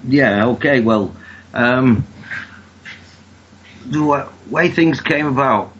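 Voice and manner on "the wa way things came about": ordinary delivery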